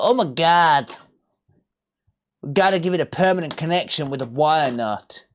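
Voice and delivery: mock an American fussing over wiring; a hammed-up American accent. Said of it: "I can't do an American accent".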